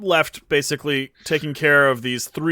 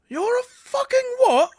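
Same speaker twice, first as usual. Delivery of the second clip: very high, slow incredulous squeak; high slow flabbergasted question; stunned disbelief. Acted. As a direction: stretch it out in shock.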